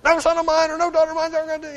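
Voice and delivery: high-pitched yelling, fast and frantic; mimicked parent yelling. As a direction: act out a parent screaming at the kids. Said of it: "and yelling".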